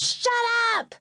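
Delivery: put-on little boy screaming at his siblings; kid's scream. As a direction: scream it like an angry little brother. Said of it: "And Bruce screamed".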